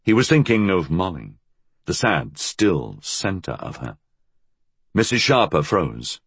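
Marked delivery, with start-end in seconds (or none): none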